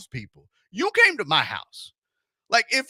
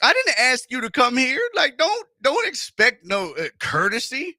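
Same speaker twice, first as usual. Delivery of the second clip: heated rant; 9 semitones higher than usual. Real, not acted. Heated rant about salespeople.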